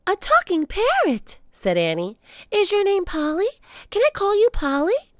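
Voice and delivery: put-on high, bright child's voice; thrilled girl talking to a bird; delighted little sister; excited. A narrator's reading. Annie delighted by talking parrot.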